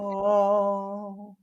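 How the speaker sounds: high drawn-out monk chant; monk impression. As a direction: intone it like a monk. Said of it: "that was me monk impression".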